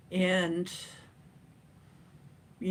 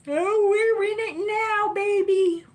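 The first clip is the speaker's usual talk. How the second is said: high, loud, drawn-out crowing; excited whoop; 14 semitones higher, 8 dB louder than usual. Real, not acted. Host crowing to viewers that March is here, excited. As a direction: stretch it out in a loud, high cheer.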